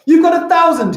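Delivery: high, loud, disbelieving cry; shouted amazement at an absurd claim; incredulous exclamation. Real, not acted. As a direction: shriek it in mock astonishment. Astonished at pupil's claim.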